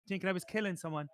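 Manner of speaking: ordinary delivery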